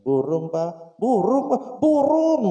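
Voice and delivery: rising from plain to high loud yell; building irritation. Acted. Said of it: "Coba kesel kan anak". (makin lama makin kesal berteriak)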